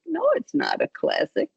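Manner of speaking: high-pitched inner objection; mock protest. Teacher privately disputing what kids call a classic, high mock protest.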